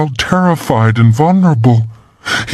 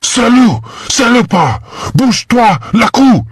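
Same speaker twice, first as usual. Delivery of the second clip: screaming in French; 12 semitones higher than usual. A narrator's reading. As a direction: shriek the French words at the intruders. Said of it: "Marissa was screaming in rapid French".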